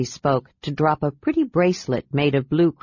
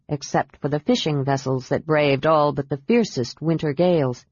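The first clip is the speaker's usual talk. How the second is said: plain reading voice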